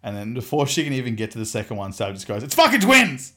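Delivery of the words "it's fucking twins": loud, high mock yell; excited blurting. Partner blurting news to her mum, shouting excitedly. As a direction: blurt it out at the top of your pitch.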